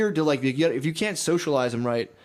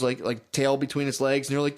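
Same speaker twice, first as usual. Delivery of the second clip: ordinary talk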